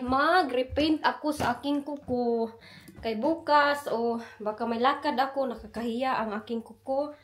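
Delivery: normal talking voice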